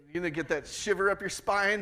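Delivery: normal talking voice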